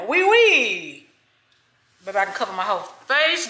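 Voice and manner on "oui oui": playful fake French accent, higher; mock French painter. Vlogger playing painter with her baggy cap, mock French accent. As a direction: ham up a French painter in a beret. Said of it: "Or is it a French painter?".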